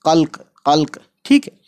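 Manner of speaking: कल्क, not कलक्का or कलकी; कल्क with no vowel after the last k; clipped final k. Shown as a demonstration. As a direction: say कल्क and cut the sound dead on the k. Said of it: "यहाँ पर आवाज़ लाकर छोड़ देंगे".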